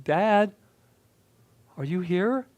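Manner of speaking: put-on a scared boy's high quivering call. A narrator's reading. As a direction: call out, voice shaking with fear. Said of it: "My voice quivered as I called out again".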